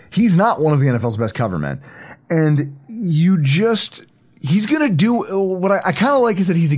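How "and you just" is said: ordinary talk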